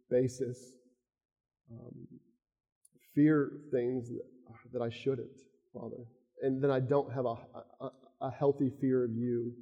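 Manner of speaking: soft, hushed personal prayer to the Father; quiet prayer. Acted. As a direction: pray humbly, almost to yourself. Leads a closing prayer.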